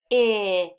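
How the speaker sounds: sounds split and punched: K-it; sounded out. Shown as a demonstration. Teacher to class, tapping out the sounds. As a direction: tap out each sound of K-it, loudly. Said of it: "One more time".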